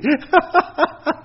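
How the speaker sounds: high laugh at the irony; amused laugh. Real, not acted. The monk laughing at the neighbor's son asking for a loan, high laugh. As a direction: let a high laugh break out.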